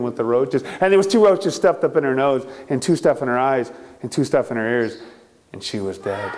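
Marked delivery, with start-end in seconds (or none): 5.5-6.3 low, much softer ominous hush; dark hushed storyteller line; hushed reveal. A narrator's reading. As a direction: drop the voice for the reveal.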